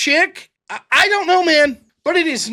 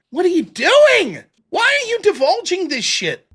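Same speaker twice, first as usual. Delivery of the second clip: exasperated plea; 9 semitones higher, 3 dB louder than usual. Real, not acted. Streamer scolds Connor for posting: loud exasperated. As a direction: demand an answer in exasperation.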